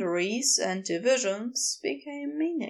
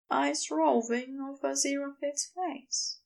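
usual speaking voice

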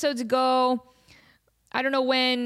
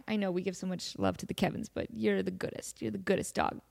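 soft, sweet talk to a pet; 2 semitones lower, 8 dB quieter than usual. Real, not acted. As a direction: coo at the dog. Talking to her dog.

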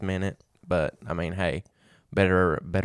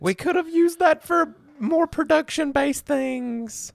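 much higher, slow put-on voice; high mock voice; 5 dB louder, slower than usual. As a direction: whine it in a squeaky voice.

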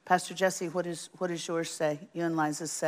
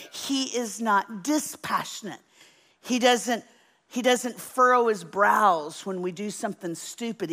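higher, louder preacher build; preaching at full force; 4 semitones higher, 3 dB louder than usual. Acted. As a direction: drive it home from the pulpit. Preaching climax.

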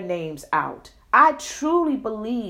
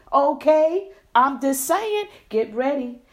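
normal talking voice

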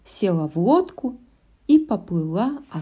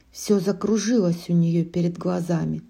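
ordinary delivery, nothing marked

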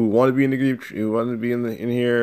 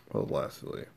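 soft whispered aside; 10 semitones lower, 13 dB quieter than usual. Acted. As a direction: whisper it under your breath. Repeats the word under breath.

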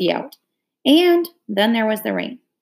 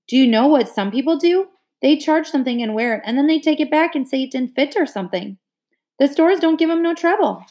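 higher, eager interruption; bursting in; 7 semitones higher than usual. A narrator's reading. Brenda bursting in with her scheme, eager. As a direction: jump in keen to share a scheme. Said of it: "Brenda burst in".

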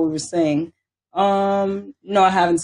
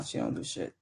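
unvoiced whisper; whispered dig at grandma; whispered aside; 13 semitones lower, 16 dB quieter than usual. Real, not acted. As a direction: slip it out under your breath. Quiet aside about grandma.

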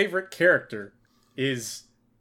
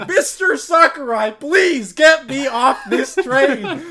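high, loud, very slow laughing plea; pleading animators; 9 semitones higher, 7 dB louder, much slower than usual. Retold or imitated. Animators begging their boss for escape, slow laughing plea. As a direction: beg through laughter, drawn out. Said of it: "animators are sitting here being like".

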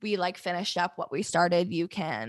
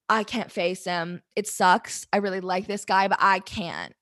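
normal talking voice

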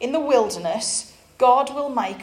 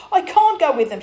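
breathless, frightened protest, louder and higher; gasped; 5 semitones higher, 7 dB louder than usual. A narrator's reading. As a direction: gasp it out in panic. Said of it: "she gasped".

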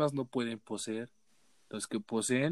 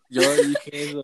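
laughing; 2 semitones higher, 8 dB louder than usual. Real, not acted. Admits it, laughing.

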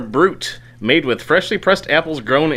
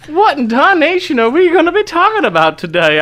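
much higher, louder hammed Southern twang; mock Southern accent; 5 dB louder than usual. Host asking the topic in a hammed-up Southern accent. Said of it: "by using that accent".

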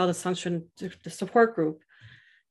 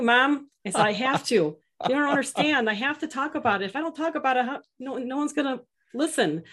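pleading with mom; 7 semitones higher, 4 dB louder than usual. Retold or imitated. Son pleading back to his mom.

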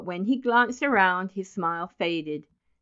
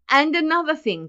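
raised, louder, insistent voice; stern scolding; 8 semitones higher, 5 dB louder than usual. A narrator's reading. Roscoe continuing to lecture Benjamin, sternly. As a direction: press on with the telling-off.

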